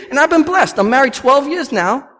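ordinary delivery

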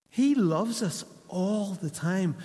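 slow emphasis. Real, not acted. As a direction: spell it out slowly. Preacher's slow emphatic statement.

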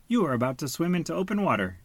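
fast, high scolding; accusing. A narrator's reading. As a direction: accuse him quickly. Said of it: "he accused".

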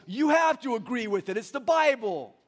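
emphatic, hammed-up objection; objecting churchgoer. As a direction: imitate an indignant congregant insisting. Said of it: "some of you go".

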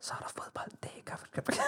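unvoiced, very soft hushed narration; imitating the whispering TV narrator. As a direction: whisper like the old children's show voice. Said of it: "den der stemme, der hvisker".

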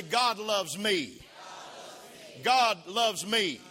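slow, loud, every word weighted; slow emphatic preaching. Acted. Pastor declaring to the congregation, slow and loud. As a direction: lean on each word like a sermon.

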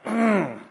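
short closed-mouth hum of frustration; frustrated grunt. Shown as a demonstration. Preacher showing the author's frustration, a closed-mouth mm. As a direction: let out a fed-up mm. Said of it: "You just go".

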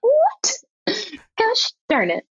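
a very high squeaked "What?", then a lighter mild curse; mock shock. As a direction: act startled and let down in fun. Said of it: "and I was like".

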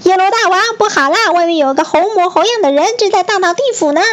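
high-pitched, fast panicked little voice; flustered report. A narrator's reading. Little ghosts report havoc to King Yama, flustered. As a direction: rush it out in a high, flustered squeal. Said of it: "慌慌张张地向阎罗王报告".

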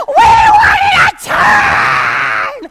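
drawn-out, high, very loud mock scream; hammed-up kiddie tantrum scream; screaming kids. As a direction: imitate children shrieking in protest. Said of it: "so they're like".

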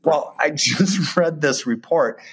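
slow words through a nervous laugh. Real, not acted. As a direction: laugh uneasily while speaking. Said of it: "I guess it's nervous laughter".

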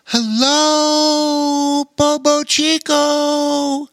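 high, slow drawn-out call. A narrator's reading. Elf calls down from the hilltop, high and drawn out. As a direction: hail him from the tower far above. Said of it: "She called out".

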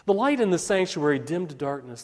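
usual speaking voice

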